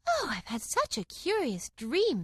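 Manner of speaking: slow, high, wondering; dreamy girl. A narrator's reading. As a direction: tell it full of wonder. Alice just woke.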